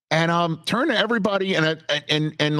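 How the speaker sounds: ordinary talk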